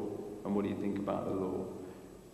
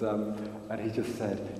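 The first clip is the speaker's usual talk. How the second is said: ordinary delivery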